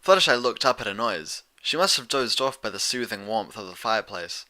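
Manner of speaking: ordinary delivery, nothing marked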